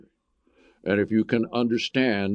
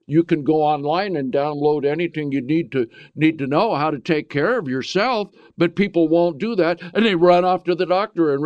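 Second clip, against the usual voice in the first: louder, higher preaching; impassioned sermon, louder; full-force preaching; 6 semitones higher, 4 dB louder than usual. Acted. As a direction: drive the point home from the pulpit.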